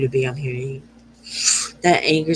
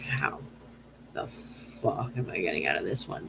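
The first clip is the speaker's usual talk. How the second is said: much softer, lower mumbled despair; imitating quiet despair; 9 semitones lower, 14 dB quieter than usual. Voicing a client stuck in a hard talk, quiet and low. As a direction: mutter it like someone cornered. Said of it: "You're, you're like".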